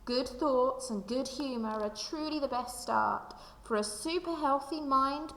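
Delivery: usual speaking voice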